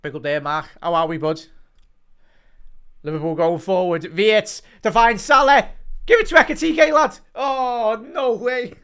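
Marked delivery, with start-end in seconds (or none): 3.0-8.7 much higher and louder, climbing to a yell at the chance; excited commentary. Real, not acted. Fan streamer yells as Liverpool break forward, excited. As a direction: roar the attack home as it builds. Calling a Liverpool attack live.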